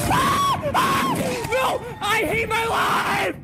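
screaming. Real, not acted. Screaming at loss.

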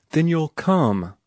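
high, slow and bright with joy; delighted outburst, slowly; joyous cry. A narrator's reading. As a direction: cry it out joyfully, drawn out. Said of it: "she cried joyously".

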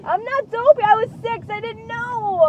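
wounded, whiny comeback; defensive whine. Real, not acted. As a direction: insist it wasn't your fault. Rebuts being called dopey.

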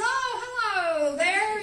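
high, slow, drawn-out hello called across the yard; sing-song greeting to passing strangers; friendly call out. Retold or imitated. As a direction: hail them warmly from a distance. Calling out to strangers.

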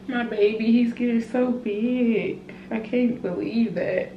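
real tears breaking through her words. Real, not acted. Mom vlogging about her growing baby, tearfully choked up. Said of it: "I'm really crying".

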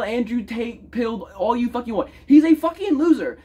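normal talking voice

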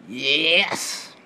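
high, loud triumphant burst; sudden yell. Pretend. Teen goofing for his camera, shouting it out. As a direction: snap out of the whisper with a yell.

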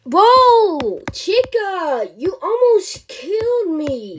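drawn-out, startled complaint; alarmed exclamation. Acted. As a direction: cry out, stretching the words. Acted shock at near death.

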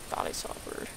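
soft unvoiced whisper. Real, not acted. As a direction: whisper it, disappointed. Whispered correction after bird.

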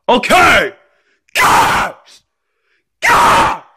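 exasperated yell into a scream. Acted. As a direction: give in with a high tantrum shout. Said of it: "Stop screaming".

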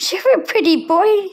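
high excited coo. Real, not acted. Praising the cat, high excited baby talk.

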